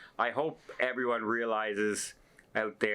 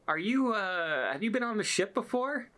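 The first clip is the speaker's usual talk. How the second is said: higher, hesitant polite question; hesitant crew man; 10 semitones higher than usual. Retold or imitated. Photo worker shyly asking the vlogger, voiced higher.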